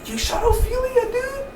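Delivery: can't believe what he just saw; shocked disbelief. Real, not acted. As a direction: voice jumps way up in disbelief.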